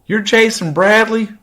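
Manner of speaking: high, loud mock hillbilly woman; hillbilly Kentucky accent. Stepmother recognizing him at the pharmacy, mock Kentucky hillbilly accent. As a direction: put on a shrieky Kentucky hillbilly voice. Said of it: "ignorant hillbilly Kentucky fucking accent".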